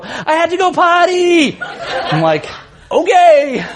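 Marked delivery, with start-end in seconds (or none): 0.0-1.6 put-on high, far-carrying little-kid call; young son announcing. Son announcing his bathroom trip to the house, calling out. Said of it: "I hear Cole's voice go". 2.9-3.6 calling back. Retold or imitated. Dad calling back to his son.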